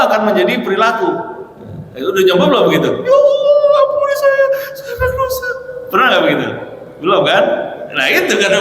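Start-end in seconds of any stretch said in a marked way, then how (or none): 3.0-5.5 hammed-up high wail; pretend crying. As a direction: fake sobbing confession, pitched way up. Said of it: "gimana cara pura-pura nangis".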